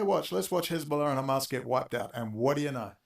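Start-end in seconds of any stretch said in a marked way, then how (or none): none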